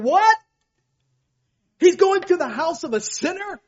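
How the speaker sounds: high, loud outraged grumbling; indignant crowd. Acted. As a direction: play the scandalised crowd, shocked and resentful. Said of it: "The rest of the crowd's going".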